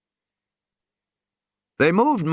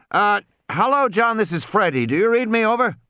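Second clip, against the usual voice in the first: high crackly radio caller; 10 semitones higher than usual. Acted. Captain hailing over the radio. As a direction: hail over the radio, pitched up.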